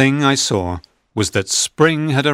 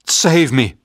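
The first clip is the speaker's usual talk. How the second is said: loud, higher plea for help; frightened shout; 4 semitones higher, 4 dB louder than usual. A narrator's reading. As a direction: shout for rescue in fear. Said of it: "I shouted".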